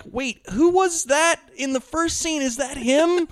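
mock confused viewer asking about the plot; confused girlfriend. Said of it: "Molly is like".

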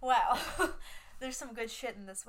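usual speaking voice

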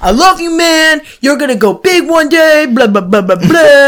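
high, loud gushing praise; gushing wrestler. Retold or imitated. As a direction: gush like a bro wrestler. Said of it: "the other guy's like".

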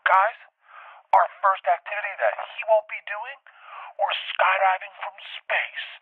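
high, loud, slowed reveal; excited announcement. Retold or imitated. Host announcing the activity to the couple, hyped. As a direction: build up the big reveal.

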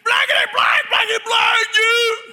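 high, loud imitated yell; mock screaming. Preacher imitating the demoniac screaming curses at him. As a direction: shriek the cursing like a wild man.

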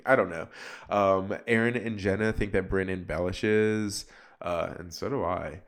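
usual speaking voice

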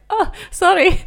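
a hammed-up Norwegian accent, high and loud; bad Norwegian accent. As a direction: do a terrible Norwegian accent for his apology. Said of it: "that was my terrible Norwegian accent".

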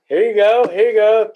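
calling out. Real, not acted. Host calling viewers in.

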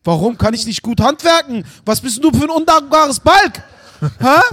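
loud, high-pitched indignant dad rant; mock-outraged father raising his voice; mock shouting. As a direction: yell it like an outraged father, for laughs. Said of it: "weil du grad auch schreist".

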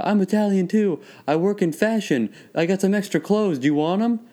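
high-pitched mock Italian hustler; lightly cartoonish Italian accent; Mario accent. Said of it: "classic Mario accent".

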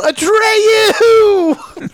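high, long, wailing yell. Shown as a demonstration. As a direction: cry the name out as the shot misses. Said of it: "I would yell".

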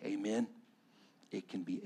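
soft, low, earnest preaching; hushed pastoral appeal. Acted. Pastor urging the wounded to go to Jesus, hushed and earnest. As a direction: bring it down to a quiet, personal plea.

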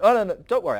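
hammed-up God waving off worry; mock reassurance. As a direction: overeager reassurance, loudly. God reassures angels about tree.

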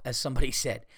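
ordinary talk, nothing marked

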